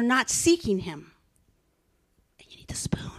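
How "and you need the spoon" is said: breathy whispered aside. Real, not acted. Preacher whispering a pointed aside to the congregation.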